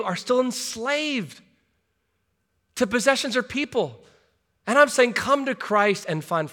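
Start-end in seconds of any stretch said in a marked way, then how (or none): none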